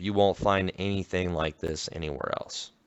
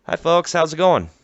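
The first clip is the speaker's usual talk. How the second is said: shopkeeper voice; 9 semitones higher, 7 dB louder than usual. Acted. Shopkeeper NPC greeting.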